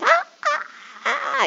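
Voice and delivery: put-on high excited squeals of kids; imitating children shrieking with delight. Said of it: "they're like".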